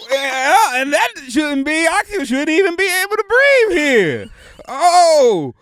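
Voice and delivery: loud, high cartoon amazement; Sandy Cheeks. Cartoon newcomer meeting a talking sponge, loud astonished character voice. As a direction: project high as a startled Southern cartoon. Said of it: "gotta get my higher register".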